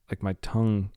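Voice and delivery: usual speaking voice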